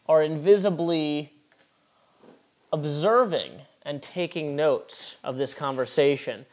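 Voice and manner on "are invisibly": ordinary delivery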